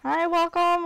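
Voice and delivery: bright high-pitched hello; sing-song welcome to the viewer; high greeting. Acted.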